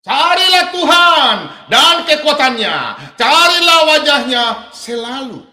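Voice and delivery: fiery sermon reading; preaching at full force. Acted. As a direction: thunder the verse out to the congregation, slowly. Preacher proclaims Psalm verse.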